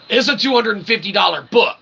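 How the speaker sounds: usual speaking voice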